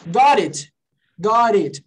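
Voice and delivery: "Got it" with the T tapped as R; R sound in "Got it". Shown as a demonstration. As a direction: flick the T in "Got it" into a quick R. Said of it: "som de R". ("Got it" com som de R no lugar do T)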